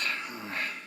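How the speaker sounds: a long, low, tired sigh; heavy sigh. Real, not acted. Weary sigh before admitting money troubles. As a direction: sigh as the weight sinks in.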